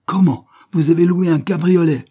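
high, loud incredulous question; scandalized astonishment. A narrator's reading. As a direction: exclaim as if scandalized. Cornélie aghast at the hired cab. (stupeur scandalisée)

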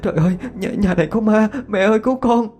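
put-on high, frightened girl's cry; panicked wail. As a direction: wail for help in terror. Girl panics that house is haunted.

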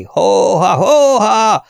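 high, drawn-out cattle call; distant herding shouts. A narrator's reading. Herders chasing the runaway cows, distant calling. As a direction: holler as if across open country. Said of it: "kiáltásokat".

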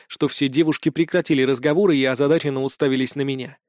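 usual speaking voice

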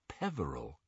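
soft, half-remembered murmur; quietly recalling a name; hushed inner thought. A narrator's reading.

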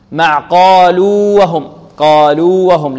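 قالوا with a long loud u, then a light وهم; clear separation. Shown as a demonstration. Teacher modelling the rule's example, separated vowels. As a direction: hold قالوا slowly before وهم. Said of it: "نظهرها".

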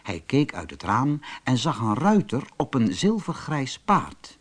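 usual speaking voice

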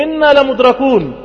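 high, loud, drawn-out cry of alarm. Acted. Moses' people as Pharaoh closes in, alarmed cry. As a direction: cry out that all is lost.

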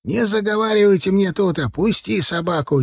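put-on high, scolding elderly voice; old man's command. A narrator's reading. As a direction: order them about like an old watchman. Old watchman orders the intruders.

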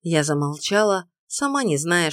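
ordinary delivery, nothing marked

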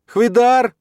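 loud, very high yell; screamed call. A narrator's reading. Stepmother calling her husband for help, screaming.

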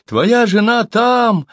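high, loud, drawn-out answer; storyteller voicing a panicked captive; choked, frightened cry. A narrator's reading. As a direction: blurt it high while being throttled. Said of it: "захрипел Сибун".

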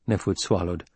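usual speaking voice

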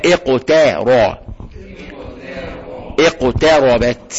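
اقتربت drawn out slowly, the ت kept light; slow teacher demo of اقتربت; slow model recitation. Shown as a demonstration. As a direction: say اقتربت slowly for the class to repeat. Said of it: "قولوا معايا".

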